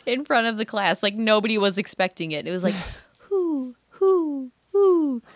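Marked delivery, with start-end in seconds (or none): 3.2-5.2 high hollow hoots; playful high owl hoots; spotted owl call. Retold or imitated. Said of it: "doing that impression".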